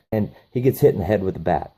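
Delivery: ordinary delivery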